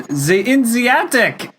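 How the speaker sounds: high, slow barked shout; mock shout. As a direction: yell it like a soldier bursting in. Nazi soldiers bang on the door.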